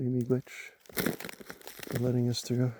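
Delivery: slow, soft reverent thanks; quiet thanks. Shown as a demonstration. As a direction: thank the spirits softly and slowly. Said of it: "and say".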